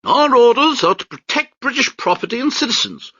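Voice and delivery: pompous British major. Acted. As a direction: sneer down at the crowd from the deck.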